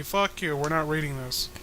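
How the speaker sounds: normal talking voice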